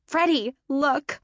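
high, eager calling out; excited. A narrator's reading. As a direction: point him out, thrilled. Girl pointing out the athlete.

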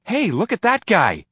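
high, loud call-out; excited shout. A narrator's reading. Roy on the bus pointing out the running boy, excited shout. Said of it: "Roy wanted to shout".

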